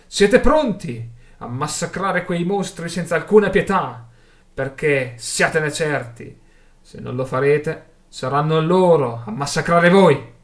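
loud, forceful call to arms; projected war-speech voice; rousing oratory. A narrator's reading. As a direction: whip up the crowd and warn them hard. Orator rallies a crowd to battle.

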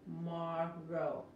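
TOMORROW very slowly, split into "too-mar-row"; syllable-by-syllable demo. Shown as a demonstration. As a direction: stretch TOMORROW syllable by syllable for learners. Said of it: "aquí también es la T de inglés".

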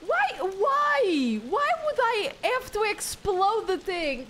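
high, baffled why; incredulous. Real, not acted. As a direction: pitch up in disbelief. Exasperated at game mechanic.

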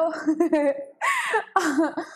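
high bright laugh; real laughing burst. Real, not acted. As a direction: laugh out in relief. Laughs at finally being heard.

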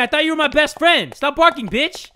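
usual speaking voice

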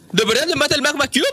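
high, fast incredulous complaint; exasperated disbelief. Real, not acted. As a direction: whine at the unfair death. Killed by magma cube, exasperated.

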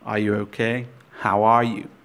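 ordinary talk, nothing marked

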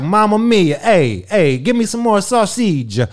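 high, lilting cartoon Italian; boisterous mock Italian; hammed-up Italian. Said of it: "I'm Italian, so you know how you, you, we talk like this".

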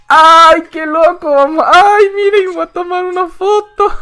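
very high, loud squealing amazement; excited squeal. Real, not acted. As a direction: shriek with delight at the screen. Gamer amazed by game creature.

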